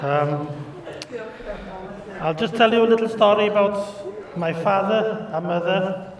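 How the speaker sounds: usual speaking voice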